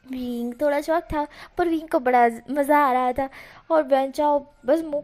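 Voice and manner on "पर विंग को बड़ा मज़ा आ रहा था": usual speaking voice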